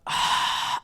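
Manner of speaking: imitated breathy huff; mock sigh of impatience; exasperated sigh. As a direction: heave a voiceless, fed-up sigh. Agent's exasperated sigh, retold.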